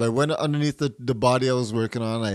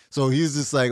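usual speaking voice

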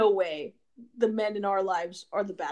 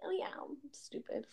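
breathy self-mocking whisper, much softer; 4 semitones higher, 16 dB quieter than usual. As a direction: whisper it like you're shrugging yourself off. Brushing off a mistake.

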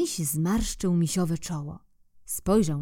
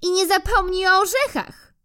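squirrel; 13 semitones higher, 5 dB louder than usual. Acted. Squirrel voiced in story.

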